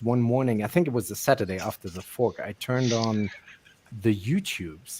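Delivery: normal talking voice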